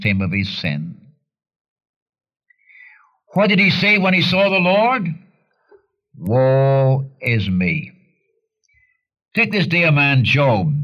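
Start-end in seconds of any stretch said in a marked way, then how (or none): 3.3-5.1 high rhetorical question. Acted. Preacher's rhetorical challenge. 6.2-7.9 slow lament. Acted. Repeating Isaiah's cry.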